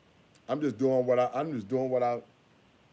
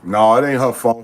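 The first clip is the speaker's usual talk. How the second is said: loud call; 3 semitones lower, 14 dB louder than usual. Real, not acted. Calls out over the kids.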